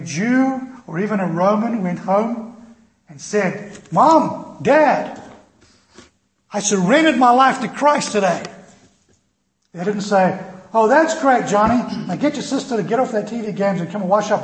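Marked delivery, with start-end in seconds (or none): 3.9-8.4 bright, eager, much louder and higher; a son bursting to tell mom and dad his news; excited announcement. Acted. Son excitedly telling his parents he surrendered his life to Christ. 10.7-14.4 mock cheerful mom, loud and high; gushing, breezy parental approval; cheery parent. Mother cheerfully praising her son before calling him to dinner.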